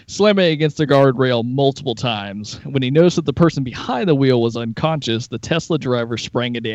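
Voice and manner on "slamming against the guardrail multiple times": ordinary talk, nothing marked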